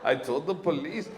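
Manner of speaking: normal talking voice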